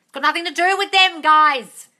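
loud, high, emphatic scolding; loudly fed-up coach; exasperated insistence. Real, not acted. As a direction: raise your voice to drive it home. Exasperated coach hammering point home.

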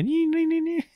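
high-pitched "nee nee" winding sound; squeaky little winder imitation; imitated film winder noise. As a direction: mimic a little crank being wound. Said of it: "the little handle and you go".

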